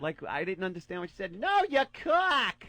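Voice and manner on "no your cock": put-on high, slow drunk Boston woman; Boston accent. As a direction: drawl it like a tipsy Boston girl. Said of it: "the Boston accent".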